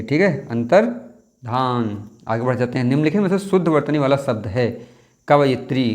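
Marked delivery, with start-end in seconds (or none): 0.5-2.1 अंतर्धान in two slow parts, antar, dhaan; slow, careful अंतर्धान with no y; full "dhaa". Shown as a demonstration. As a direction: break अंतर्धान before a long "dhaan". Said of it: "धा पूरी बनती है".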